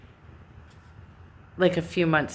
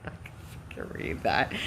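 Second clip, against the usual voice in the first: muttered refusal; 10 semitones lower, 9 dB quieter than usual. Retold or imitated. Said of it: "And I'm like".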